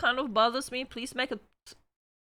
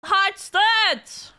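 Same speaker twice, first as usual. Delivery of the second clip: loud, higher shout of the name; calling out; 8 semitones higher, 6 dB louder than usual. Real, not acted. Streamer loudly calls out the rerolled winner. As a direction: announce the new winner.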